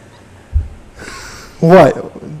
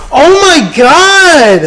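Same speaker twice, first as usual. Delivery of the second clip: drawn-out slow exclamation, higher; shocked; 7 semitones higher, much slower than usual. Real, not acted. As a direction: stretch it out in disbelief. Reacting to book picture.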